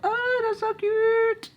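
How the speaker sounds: very high, drawn-out cooing; stretched-out squeal of delight. Real, not acted. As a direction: gush slowly in a squeaky high pitch. Admiring match with her shirt.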